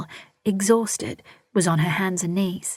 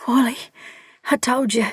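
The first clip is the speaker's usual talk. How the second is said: panting; 6 semitones higher than usual. A narrator's reading. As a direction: gasp it out between pains. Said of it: "a female voice pant".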